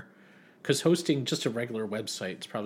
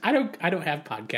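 slightly higher mock mom; hammed-up confused mom; 5 semitones higher, 5 dB louder than usual. Mom's answer acted.

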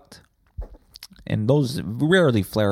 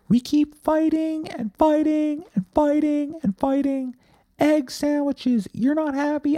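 very high-pitched put-on voice, pleading through a breakup; comically high falsetto argument. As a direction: squeak out the break-up speech way up high. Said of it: "talking in a high voice like that".

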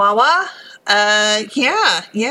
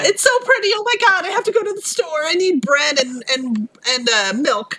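much higher, gushing then fretting; mock excited panic; 8 semitones higher than usual. Host playing her own snow panic for friends, mock excited. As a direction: pretend to gush at the snow, then flap about bread.

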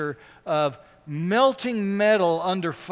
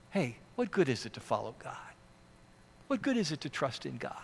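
scoffing neighbor; 6 semitones lower, 11 dB quieter, faster than usual. Retold or imitated. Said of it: "the naysaying neighbor argues".

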